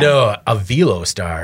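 Velostar said vee-LOH-star; mispronounced Velostar. Retold or imitated. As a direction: stretch out Velostar. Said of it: "he'd call it the Velostar".

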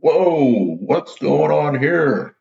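mock surprise. Son pretending surprise at dad on the call, high and slow. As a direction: ham up the startled whoa, stretching it. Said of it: "I'm like".

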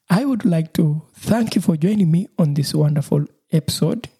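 ordinary talk, nothing marked

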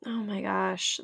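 awed whisper. Real, not acted. Host moved after guest's speech.

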